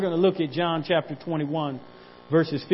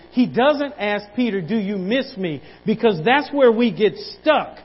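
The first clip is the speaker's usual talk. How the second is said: higher, louder preacher's cry, slowed at first; full-force preaching; 6 semitones higher, 5 dB louder than usual. Acted. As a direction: preach it out, building.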